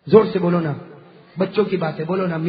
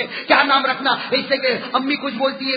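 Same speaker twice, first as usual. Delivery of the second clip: usual speaking voice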